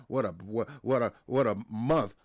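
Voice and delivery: usual speaking voice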